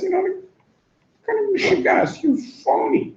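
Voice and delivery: a shrill-free mock jeer, high pitched; mocking shriek. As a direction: ridicule the phony. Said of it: "somebody says".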